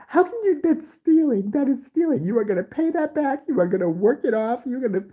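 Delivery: a mother scolding her son in shock. Retold or imitated. Mother scolding about stealing.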